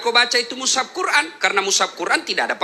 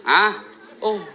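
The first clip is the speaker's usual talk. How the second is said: ordinary talk, nothing marked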